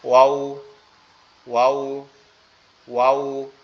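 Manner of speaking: Arabic waw with round lips. Shown as a demonstration. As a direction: pout the lips round on ওয়াও. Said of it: "ঠোঁট দুইটা একবারে গোলাকৃতি হবে".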